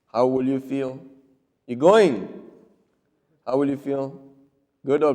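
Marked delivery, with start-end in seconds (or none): 1.7-2.4 curt demand with a falling "going"; confrontational, punched "going"; curt, accusing tone. Shown as a demonstration. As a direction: put them on the spot. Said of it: "how is my tone of voice".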